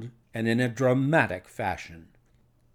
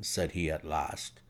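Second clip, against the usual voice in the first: ordinary delivery, nothing marked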